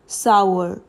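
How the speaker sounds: "sour" as "sau-er", two syllables, falling; correct sour. Shown as a demonstration. Teacher gives the right "sour" clearly. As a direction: say "sour" like "sow-er". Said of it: "The correct pronunciation to this word is".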